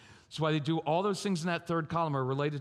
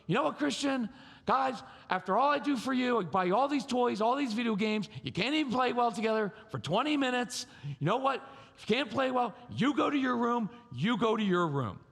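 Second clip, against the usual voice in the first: acted exasperated mom, voice pitched up; mom yelling; 6 semitones higher than usual. Mom sends squabbling kids to their rooms, yelling. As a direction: scold the kids in a high, fed-up rant. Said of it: "Mom's yelling".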